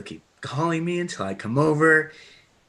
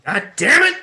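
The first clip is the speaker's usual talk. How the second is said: angry shout; 9 semitones higher, 6 dB louder than usual. Acted. Cameron hitting seat in frustration.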